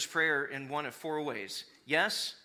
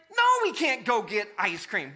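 louder firm refusal; exasperated parent no; 3 semitones higher, 7 dB louder than usual. Retold or imitated. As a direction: shut the kids down sharply.

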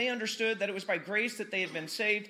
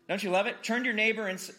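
ordinary talk

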